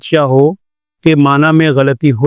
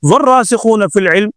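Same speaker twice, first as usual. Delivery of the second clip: والراسخون begun afresh on higher pitch; separate new sentence; 4 semitones higher than usual. Shown as a demonstration. Teacher showing a separate clause, والراسخون launched higher after a pause. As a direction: start والراسخون as a new phrase after the break. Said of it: "یہ آگے الگ جملہ ہے".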